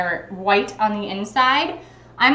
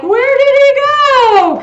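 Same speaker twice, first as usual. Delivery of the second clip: high, loud, slow pretend search; hammed-up where-did-he-go; mock baby talk; 13 semitones higher, 7 dB louder, slower than usual. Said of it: "we had to pretend and be like".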